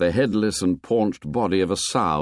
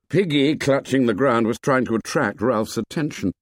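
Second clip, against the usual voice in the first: usual speaking voice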